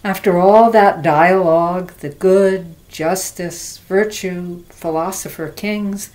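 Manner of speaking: measured recital with forceful openings, dropping off at line ends; poetry reading. Acted. Recites her poem.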